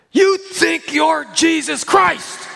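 loud, high furious shout; angry yell. Retold or imitated. Angry church member yelling at the pastor. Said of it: "Man, he yelled".

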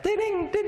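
high-pitched little dinging; ding like a few small coins falling; imitated coins dropping. Preacher mimics three dimes dropping for the church, tinkling high. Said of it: "like, three three dimes dropping".

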